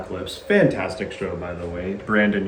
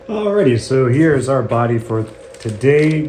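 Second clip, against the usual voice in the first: excited; 4 semitones higher, 6 dB louder than usual. Acted. Upbeat segment opener.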